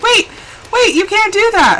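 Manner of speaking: high, loud mock objection; mock protest. Player objecting to a changed backstory, mock protest. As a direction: blurt out an imagined shocked objection.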